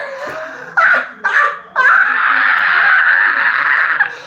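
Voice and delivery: triumphant cackle. Real, not acted. Gloating over rivals' loss.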